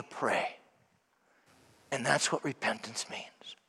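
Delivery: low, soft, prayerful homily close; hushed preaching. Acted. Priest closing his homily softly to the congregation. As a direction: draw them in softly, almost praying.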